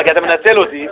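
ordinary talk, nothing marked

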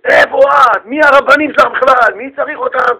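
irate acted outburst; angry husband. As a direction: berate your wife indignantly. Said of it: "והבעל התרגז".

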